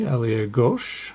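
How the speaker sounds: slow mock French ailier gauche; amateur French accent. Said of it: "My French accent is amazing".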